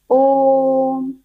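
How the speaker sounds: ओम held long, at pluta length; drawn-out om. Shown as a demonstration. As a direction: stretch ओम to three times its short length. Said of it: "तीन गुना समय लगता है". (ओम को लंबा खींचकर बोलना)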